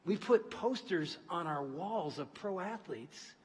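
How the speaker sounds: slow, soft intense sermon; hushed earnest preaching. Acted. Preacher to congregation, hushed conviction. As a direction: pull them in with quiet conviction.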